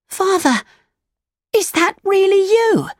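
put-on very high, astonished boy voice; amazed. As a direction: gasp it in wonder at father. Pinocchio amazed by young Geppetto.

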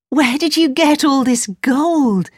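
put-on high, slow, wondering girl; amazed question. A narrator's reading. Gerda amazed at piles of gold.

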